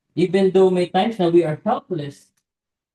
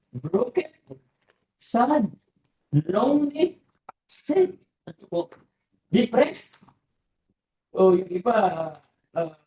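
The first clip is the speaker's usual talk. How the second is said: rising lament; 3 semitones higher than usual. Real, not acted. Listing inner pain, rising.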